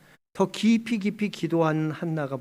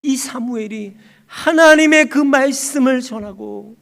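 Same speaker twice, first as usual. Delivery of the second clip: loud, high, rousing proclamation; full-force preaching; 8 semitones higher, 9 dB louder than usual. Real, not acted. Preacher proclaiming Samuel's calling, loud rousing sermon cry. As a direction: proclaim it with rising urgency, then draw out the close.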